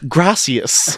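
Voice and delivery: exaggerated "Gracias" imitating a tourist; hammed-up Spanish on "Gracias"; overpronounced Spanish. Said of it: "overpronounce everything".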